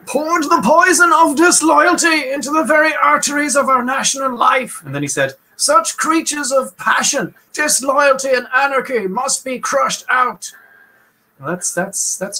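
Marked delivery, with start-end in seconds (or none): 0.1-4.8 put-on high, slow, old-time orator voice rising to a shout; Woodrow Wilson impression. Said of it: "despite this stupid accent I'm doing". 5.5-10.5 put-on high, slow, old-time orator voice rising to a shout; Woodrow Wilson impression. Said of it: "despite this stupid accent I'm doing".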